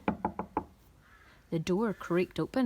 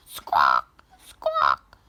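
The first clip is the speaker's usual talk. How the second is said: hen squawk; 10 dB louder, much slower than usual. A narrator's reading. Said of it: "It was a hen".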